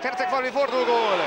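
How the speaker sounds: loud rising goal call; goal shout. Real, not acted.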